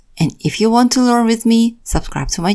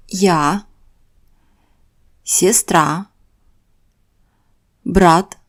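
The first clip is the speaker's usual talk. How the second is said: ordinary talk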